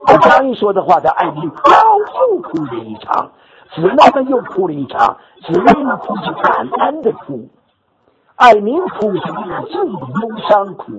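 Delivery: high, swelling retelling of the weeping; high-pitched, moved recounting; excited. Real, not acted. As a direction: lift the voice as the joy spreads. Everyone weeping after the announcement.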